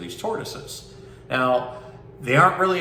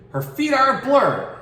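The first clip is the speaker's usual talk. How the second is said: higher, louder rush of excitement; excited; 13 semitones higher, 5 dB louder than usual. A narrator's reading. Store keeper reading aloud, excited narrator voice. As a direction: make the blur sound thrilling.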